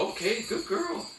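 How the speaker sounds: high-pitched praise for a dog. Real, not acted. Trainer to dog as she targets bells, high happy praise.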